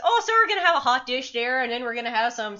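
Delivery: high, chatty Minnesotan lilt; folksy Minnesotan potluck talk; Minnesotan accent. Acted. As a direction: ramble on as a nice Minnesotan. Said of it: "but Minnesotan".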